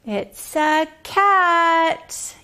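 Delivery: slow, high reveal; delighted answer to a child; excited reveal. Acted. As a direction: stretch the answer like a surprise. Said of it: "big reveal".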